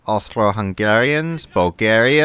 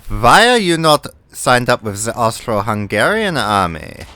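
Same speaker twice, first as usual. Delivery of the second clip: put-on German accent, stiff and formal; self-admitted bad German accent. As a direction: play a German officer, badly.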